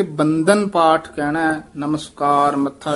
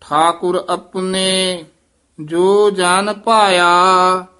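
slow, measured scripture chanting; slow recitation; 3 semitones higher, 5 dB louder, much slower than usual. Shown as a demonstration. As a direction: recite each phrase slowly and deliberately.

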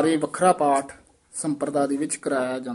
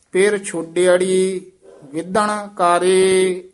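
measured, sustained Gurbani recitation; scripture recitation; 2 semitones higher, 6 dB louder, slower than usual. Shown as a demonstration. Teacher reciting verse for students, sustained recitation. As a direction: hold the line endings long and even.

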